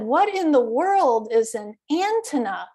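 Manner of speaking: puzzled question, ANTENNA stressed as AN-tenna; misplaced stress. Retold or imitated. Woman in line asking what antenna is, misplaced stress on AN. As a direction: echo the odd AN-tenna with first-syllable stress on antenna. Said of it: "he had the stress on the wrong syllable".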